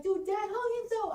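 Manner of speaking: high-pitched, strained mock yell; retold tearful yelling. Drunk daughter yelling at Jenna about Dad, distraught. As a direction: imitate an upset yell, pitch way up. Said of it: "I was yelling at Jenna".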